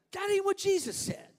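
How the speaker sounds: loud, high denial; emphatic rebuke. Real, not acted. Preacher rejecting the sentimental view, loud and high. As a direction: shoot it down hard.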